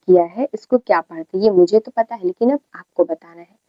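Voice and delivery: ordinary talk, nothing marked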